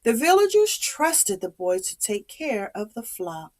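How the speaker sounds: ordinary delivery, nothing marked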